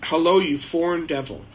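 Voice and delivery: normal talking voice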